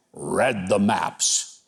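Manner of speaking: very slow, low, drawn-out quote; deadpan scorn, each word dragged out; mocking quote. Mocking a politician's quoted boast.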